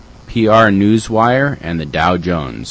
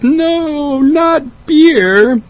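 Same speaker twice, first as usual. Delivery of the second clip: high mock alarm; 4 dB louder, much slower than usual. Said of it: "get us both a laughing".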